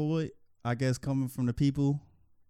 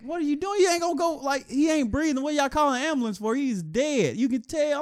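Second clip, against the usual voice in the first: high, loud outraged yelling; outraged bystander; 13 semitones higher, 3 dB louder than usual. Retold or imitated. Bystander yelling that the man is dead, high outraged shout. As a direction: shout it in disbelief at the cops. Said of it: "He's like".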